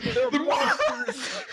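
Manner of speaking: slow, breaking into high laughter; laughing mock horror. Police gawking at the turtles, laughing mock horror. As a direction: act it out while cracking up.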